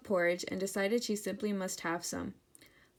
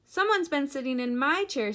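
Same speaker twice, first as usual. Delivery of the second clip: higher, louder, middle-sized bear voice; Mama Bear; 6 semitones higher, 5 dB louder than usual. A narrator's reading. Mama Bear sees her chair, middle-sized voice. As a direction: raise it up as the mother bear. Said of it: "in her middle-sized voice".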